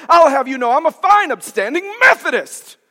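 loud, high-pitched huffy imitation; indignant churchgoer. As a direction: declare it loudly, mock-offended. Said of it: "she clamped it to her chest and she said".

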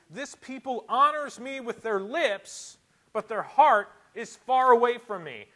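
loud, high, emphatic pulpit voice; full-force preaching. Real, not acted. Preacher rebuking man-made doctrines, full-force preaching. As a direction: hammer it home from the pulpit.

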